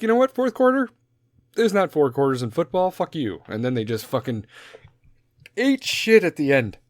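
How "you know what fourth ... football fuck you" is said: high, sarcastic dismissive voice; taunting players; mocking team voice. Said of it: "they're like".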